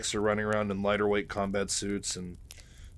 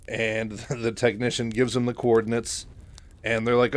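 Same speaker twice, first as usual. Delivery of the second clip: usual speaking voice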